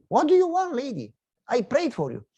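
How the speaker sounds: a hammed-up huffy inner protest; exasperated. Preacher's silent retort to the ungrateful lady, high and huffy.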